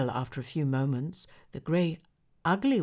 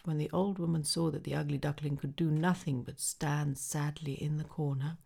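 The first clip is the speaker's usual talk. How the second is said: ordinary delivery, nothing marked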